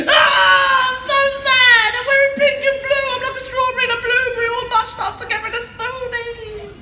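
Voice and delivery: high, loud hammed-up wail; mock crying. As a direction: bawl it like a clownish crybaby. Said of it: "You wanna cry like this?".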